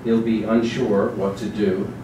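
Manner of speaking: ordinary talk, nothing marked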